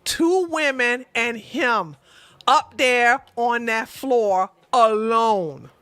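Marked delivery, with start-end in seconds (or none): none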